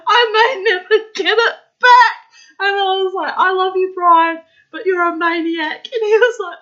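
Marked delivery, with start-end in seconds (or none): 0.1-3.3 laughing. Real, not acted. Laughing hard. 3.4-5.9 laughing. Real, not acted. Laughing fondly.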